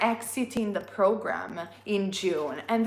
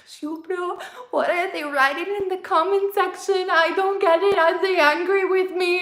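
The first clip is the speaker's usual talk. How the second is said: higher-pitched anxious questions, rising louder; worried plea to husband; 9 semitones higher, 3 dB louder than usual. Retold or imitated. Asking husband about confusing comments, worried and higher. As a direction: replay your own fretful call for help. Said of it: "I call my husband and I'm like".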